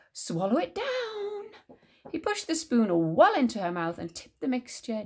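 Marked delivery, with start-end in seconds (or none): 0.1-1.5 high, stretched-out call; crying out. A narrator's reading. George urging Grandma to take the dose, crying out slowly. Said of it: "George cried out".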